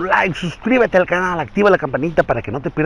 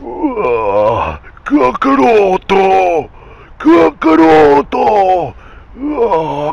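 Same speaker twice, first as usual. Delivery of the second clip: higher, slow power-up shout; Broly scream; 5 semitones higher, much slower than usual. Acted. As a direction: roar it like a raging Saiyan. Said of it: "voz de ... Broly".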